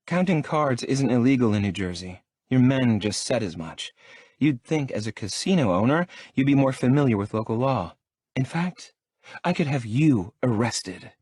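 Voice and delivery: haughty, indignant heroine; defiant young woman. Acted. Talia's defiant voice.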